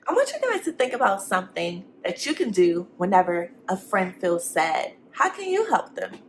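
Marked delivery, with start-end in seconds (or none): none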